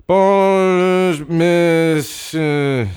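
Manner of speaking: high, loud, drawn-out approval; a pleased teacher praising the class; old teacher approving. Acted.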